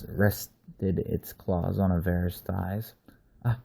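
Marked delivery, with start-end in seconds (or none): 3.4-3.6 frightened stutter. Acted. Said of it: "he stuttered".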